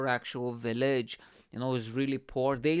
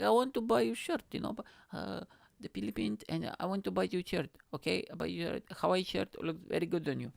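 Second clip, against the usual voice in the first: higher-pitched mock Filipino accent; imitated Filipino accent; 6 semitones higher than usual. Girlfriend offering to buy him a shirt, mock Filipino accent. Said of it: "This is how she sounds".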